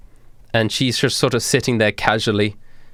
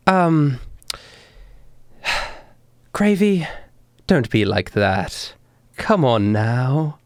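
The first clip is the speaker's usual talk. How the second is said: sighing, drawn-out reproach; a little annoyed; 2 semitones higher, slower than usual. Acted. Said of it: "looks a little bit annoyed".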